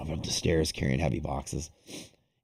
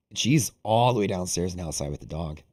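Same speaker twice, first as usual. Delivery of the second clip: ordinary talk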